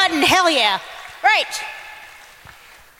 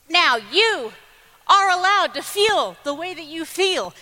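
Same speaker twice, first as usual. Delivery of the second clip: ordinary delivery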